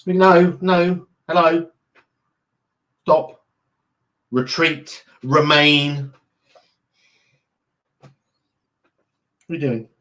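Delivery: commanding the dog. Real, not acted. Ordering the dog off window.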